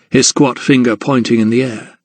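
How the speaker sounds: plain reading voice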